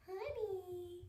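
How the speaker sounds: high, drawn-out coo to a pet; pet-talk coo. Real, not acted. Cooing to a pet.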